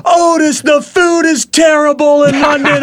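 high shouted heel promo; wrestling heel promo. Retold or imitated. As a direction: yell it at the booing crowd. Quoting a wrestling heel's promo.

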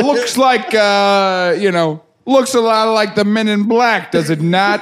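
laughing copy of a Brooklyn guy; mock New York tough guy; imitating Brooklyn accent. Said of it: "Why'd you say it like that?".

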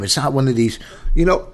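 ordinary delivery

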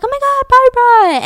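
high, loud mock squeal of recognition; excited greeting. Margaret spotting Barbara in the car, imitated excited greeting. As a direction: imitate being thrilled to spot an old friend. Delighted greeting of old friend.

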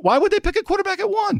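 high, disbelieving question; incredulous. Real, not acted. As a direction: ask it like it's absurd.

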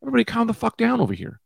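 exasperated park shout; calling out to dogs. Retold or imitated. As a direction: yell to separate the dogs. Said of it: "I'm like".

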